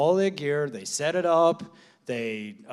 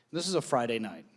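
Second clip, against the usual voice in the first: ordinary talk, nothing marked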